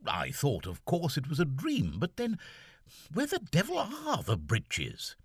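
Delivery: lightly voiced young gentleman puzzled; Seaforth's voice. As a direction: fret aloud as the lieutenant.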